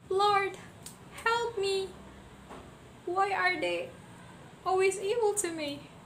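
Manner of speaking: high, pleading cry for help. A narrator's reading. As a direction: plead to the Lord in tears.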